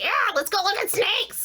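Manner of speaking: high, manic excitement; mock hyper voice, high; sugar-high crazy. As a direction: bounce like you're drunk on sweet tea. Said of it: "I'm just crazy".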